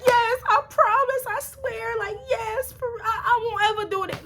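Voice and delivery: very high, squeaky mock crying; tearful promising. Teen promising the police to behave, mock tearful. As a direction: swear it desperately in an imitated tearful squeal. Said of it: "I'm really, like, crying crocodile tears".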